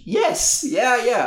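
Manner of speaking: very high, mock-enthusiastic yeses; overplayed delight at an idea; imitated eager agreement. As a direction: leap up in pitch to agree. Boston Rob enthusiastically agreeing, acted.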